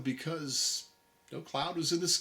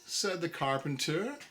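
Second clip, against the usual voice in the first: plain reading voice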